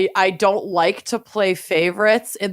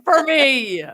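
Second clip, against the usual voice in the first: drawn-out tease; 9 semitones higher, much slower than usual. Real, not acted. Teasing friend about tattoo.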